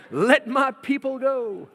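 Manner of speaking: slow, high and loud proclaiming; bold Moses proclamation. Retold or imitated. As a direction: thunder it out to Pharaoh. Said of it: "Sorry, that's another thing".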